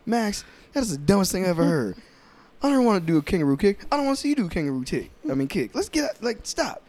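high, fast exasperated rant; exasperated partner. Retold or imitated. Rejecting Max's kangaroo kick idea, high fast exasperation. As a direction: shut down the dumb idea in exasperation. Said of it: "he's like".

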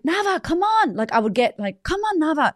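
retold exasperated mom urging him on. Retelling her impatient outburst at son.

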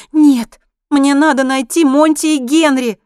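high, loud, drawn-out plea; desperate insistence. A narrator's reading. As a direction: insist loudly and urgently, stretching the words. Woman insists on her goal.

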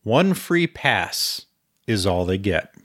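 ordinary delivery

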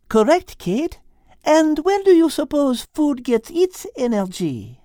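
put-on high French-accented teacher voice; french chef. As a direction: teach it like a cheerful French cook. Liz's lines.

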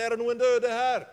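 loud, high street call; a convert on his bike yelling for the dead; shouting out. Retold or imitated. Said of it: "syklet han langs hovedgata og ropte".

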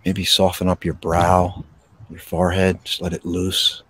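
gentle breathing-exercise guidance; guided meditation. Acted. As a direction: ease them into stillness, unhurried.

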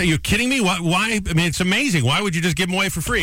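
excited disbelief; amazed. Retold or imitated. Amazed customer in joke.

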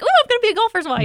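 high, fast, gushing fiancée; hammed-up dreamy golfer's wife; giddy mock excitement. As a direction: squeal it like a starry-eyed bride-to-be. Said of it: "And I'm like".

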